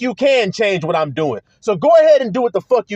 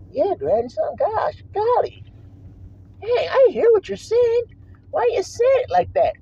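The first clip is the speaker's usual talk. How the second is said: high, thin mock voice of a complainer; pinched, high mock plea; mocking whiny critic; 10 semitones higher, 4 dB quieter than usual. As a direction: ridicule the critic by whining it up high.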